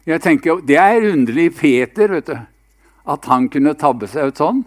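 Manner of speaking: ordinary delivery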